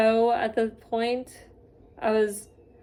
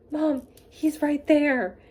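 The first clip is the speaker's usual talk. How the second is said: high, drawn-out words at usual volume; acted starstruck alert; 6 semitones higher, slower than usual. Fan in line alerts mom to the star, excited and high. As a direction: act out urgently pointing him out.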